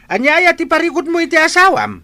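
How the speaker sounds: exasperated father scolding his son; stern scolding. Acted. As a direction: demand an answer like an angry father. Father scolding son over wife.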